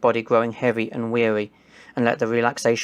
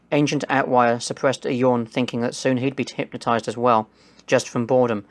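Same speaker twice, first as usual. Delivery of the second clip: ordinary delivery, nothing marked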